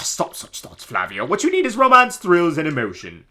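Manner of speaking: grand, high theatrical nobleman voice; pompous Flavio. Acted. Streamer voicing Flavio to the player, hammy pompous. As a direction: ham up the vain pirate-loving noble.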